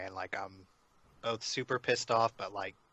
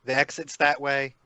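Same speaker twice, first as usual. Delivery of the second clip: raised, higher in-character call; 4 semitones higher, 9 dB louder, slower than usual. Acted. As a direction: call after someone heading the wrong way. Player calls out to Clementine.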